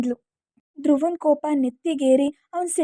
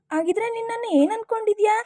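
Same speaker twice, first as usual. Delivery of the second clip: put-on higher, irritated young girl's voice; annoyed girl; 6 semitones higher than usual. Khushi tells Dhruva off, higher and irritated. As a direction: snap back like a fed-up girl.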